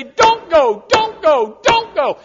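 loud, high repeated don't go, rising each time; urgent warning. Retold or imitated. Preacher warning a tempted member, loud urgent plea. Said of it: "And I say".

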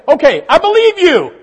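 mock stubborn believer. Believer refusing to obey.

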